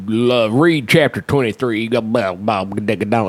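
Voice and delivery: slow, bored droning coach voice; mocking the coach reading the assignment in a slow, bored drone; lazy coach. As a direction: drone it out like a coach who doesn't care. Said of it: "his version of teaching a class is to sit there and go".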